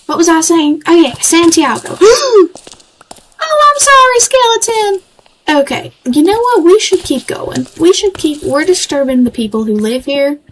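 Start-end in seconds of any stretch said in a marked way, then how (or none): 2.0-5.0 startled gasp. Real, not acted. Startled by a skeleton mob.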